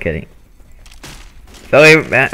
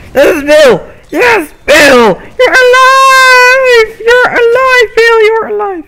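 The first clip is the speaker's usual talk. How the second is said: much higher, louder, stretched joyful cry; overjoyed shout; 7 dB louder, slower than usual. Real, not acted. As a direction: cry out in relief at finding him. Friend found alive in game.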